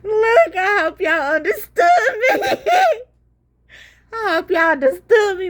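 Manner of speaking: high and loud, cracking up; laughing. Real, not acted. Pleading with viewers to get the joke, laughing. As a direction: shout it through laughter.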